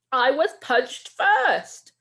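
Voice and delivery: high, loud, slow wail; wailing protest. Acted. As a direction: whine it at the teacher. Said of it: "Rohan's wailing".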